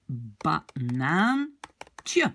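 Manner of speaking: banaan drawn out very slowly; slow model word. Shown as a demonstration. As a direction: say it slowly so learners hear each part. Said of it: "model word".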